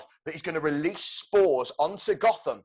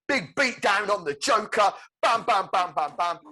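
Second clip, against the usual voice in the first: raised, loud punch-by-punch delivery; excited fight commentary; 5 semitones higher, 5 dB louder than usual. Acted. Pitching Batman's final beating of Joker, excited shout. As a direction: hammer out each blow with relish.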